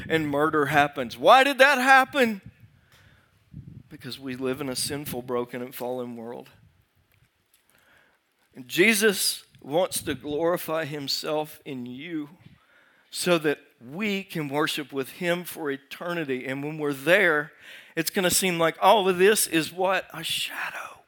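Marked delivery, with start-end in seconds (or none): 1.2-2.4 high, loud, urgent question; raised rhetorical cry. Acted. Preacher voicing people's question about murder, raised rhetorical cry. 20.1-21.0 dropped to a near-whisper; hushed answer. Real, not acted. Preacher answering his own question about this life, hushed.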